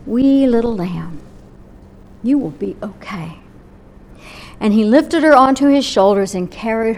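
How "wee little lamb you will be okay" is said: loving voice. A narrator's reading. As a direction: gently reassure a hurt lamb. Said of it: "in his most loving voice".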